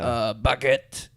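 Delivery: slow, drawn-out French "baguette"; French accent. Shown as a demonstration. Trying out the French thing in a slow mock French accent. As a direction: savour "baguette" slowly in French style. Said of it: "you wanna try the French thing".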